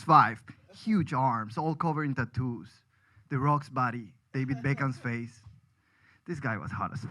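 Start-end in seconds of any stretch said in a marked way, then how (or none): none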